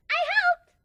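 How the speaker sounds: very high, loud squeal of glee; gleeful. A narrator's reading. Surprise cheering that she helped with the prank. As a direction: bounce it out like a proud kid. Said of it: "Surprise said gleefully".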